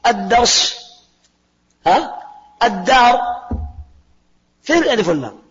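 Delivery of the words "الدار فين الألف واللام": ordinary delivery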